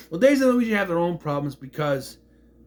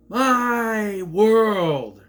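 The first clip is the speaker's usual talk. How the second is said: loud, drawn-out movie line; hammed-up dramatic delivery; dramatic exclamation; 5 semitones higher, 5 dB louder than usual. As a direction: stretch both words out grandly. Acting a character's big movie line.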